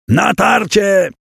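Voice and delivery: rally the knights with a slow, stretched war cry; shout. Acted. Przemysł orders the charge, drawn-out shout. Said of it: "krzyknął Przemysł".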